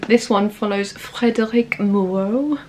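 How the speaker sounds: put-on light French accent on the name; mock French accent, a touch hammed-up. Playful French accent while reading out the plot. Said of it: "we'll stop with the French accent".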